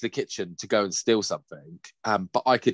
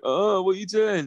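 put-on groggy child; 10 semitones higher than usual. Son woken by head bump.

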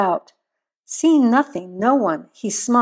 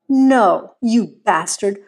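screamed curse; 4 dB louder, slower than usual. A narrator's reading. Wife facing the raised rifle, loud angry cry. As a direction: hit the refusal hard, then curse. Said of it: "She screamed again".

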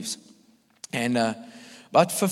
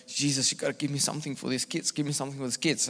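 much softer, under-the-breath prayer; quiet pleading prayer; 10 dB quieter, faster than usual. Retold or imitated. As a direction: plead softly with Jesus. Silent prayer retold in story.

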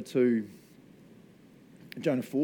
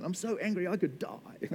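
Jonah; 7 semitones higher, 5 dB quieter than usual. Acted. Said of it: "So dramatic".

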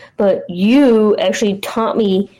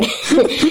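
kind gentle laugh; 7 semitones higher than usual. Real, not acted. Partner laughs at long hair remark.

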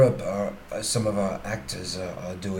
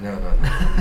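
laughing; 10 dB louder, slower than usual. Real, not acted. Laughing denial.

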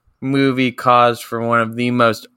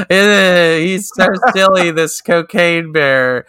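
awkward presenter; 6 semitones higher, slower than usual. Retold or imitated. Imitating the presenter's awkward joke.

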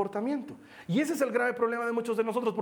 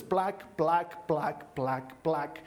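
low, evenly spaced clicking "plac"; imitated light switches; 4 semitones lower, much slower than usual. Telling how he switched on the lights, imitated clicks. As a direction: voice each switch flicking on. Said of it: "empiezo a encender luces".

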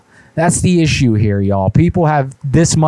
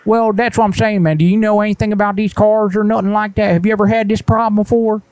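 higher, indignant put-on caller voice; prank caller voice; 9 semitones higher, faster than usual. Prank caller to the gas station, put-on higher indignant voice. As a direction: play a fussy customer who got hung up on.

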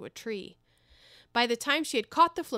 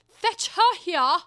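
much higher, much louder, slow and imperious; Queen's loud command; 9 semitones higher, 10 dB louder, much slower than usual. Acted. The Queen telling the executioner about the Duchess, shouting. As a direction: boom it out slowly, a royal decree.

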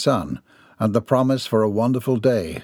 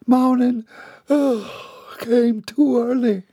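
much higher, slow and groggy; Milly groggy in the kitchen, higher and slow; sleepy young maid; 14 semitones higher, slower than usual. Acted. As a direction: drag it out, barely awake.

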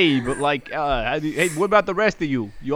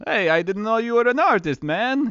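high put-on voice; guard in character; 7 semitones higher than usual. Game master as guard talks to his artist friend, high voice. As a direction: pitch it up for the guard.

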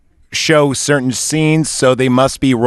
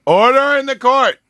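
judge shouting; 12 semitones higher than usual. Acted. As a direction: bellow it to silence the room. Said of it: "is that good?".

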